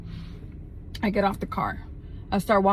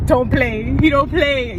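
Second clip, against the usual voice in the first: higher, louder hyped-up warning; hyped boast; 5 semitones higher, 5 dB louder, slower than usual. Real, not acted. Woman boasts about George showing up armed, hyped. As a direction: brag with raised pitch and volume.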